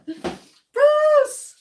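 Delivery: high, loud squeal of his name; joyful shout. Real, not acted. Streamer squealing Bruce's name at the camera. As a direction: call his name out with delight.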